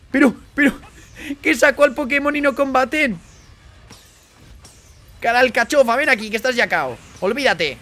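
loud, high taunting yell; shouting. Real, not acted. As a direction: bellow at the monster. Taunting the boss mid-battle.

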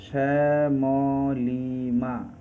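very slow, stretched Shyamolima, as শ্যা-ম-লি-মা; syllable split. Shown as a demonstration. Teacher breaks Shyamolima into syllables, syllable split. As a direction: draw out each of the four syllables in Shyamolima. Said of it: "এর syllable কি ভাঙলে হবে".

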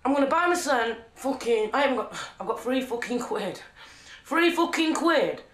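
ordinary talk

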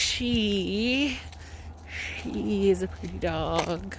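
SHE drawn out, in a slow retold correction; pointed correction. Owner correcting passers-by about her dog, pointedly. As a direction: stretch "She" long and slow to correct the mistake. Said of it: "And I'm like".

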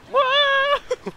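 high, loud whoa of surprise; startled whoa. Real, not acted. Player reacting to an unexpected game moment, startled whoa. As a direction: let out a sudden high whoa.

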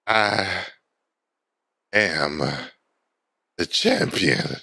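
worn-out, halting and low; exhausted. Acted. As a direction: gasp out a victory between long pauses. Spent runner claims the win.

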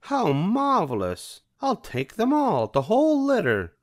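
haughty high Cruella delight; Cruella de Vil. A narrator's reading. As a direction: gloat like a rich diva. Cruella wants all the puppies.

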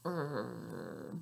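low grumpy err; grumpy growl. Acted. Reading to a child, making a grumpy face sound. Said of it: "your grumpy face".

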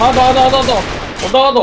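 excited callout. Real, not acted. Excited callout during a teamfight.

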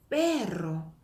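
"perro" with a strong trilled rr; emphatic Spanish trill in "perro"; rolled r. Shown as a demonstration. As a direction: roll the double r in "perro". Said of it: "강하게".

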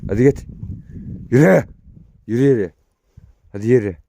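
loud high yells of yürü; shouting at dog. Real, not acted.

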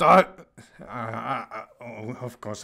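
ordinary talk, nothing marked

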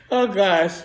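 ordinary delivery

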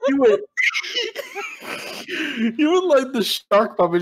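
high shouted words through laughter; laughing squeal. Real, not acted. Laughing at friend blown sky high, a squealing shout. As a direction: squeal it while cracking up.

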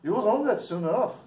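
put-on high-pitched, homemade Irish accent. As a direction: put on a rough amateur Irish brogue. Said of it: "Sorry about my English or Irish accent".

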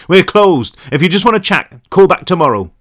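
loud officious phone voice. A narrator's reading. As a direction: brush the caller off officiously. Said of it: "the voice said".

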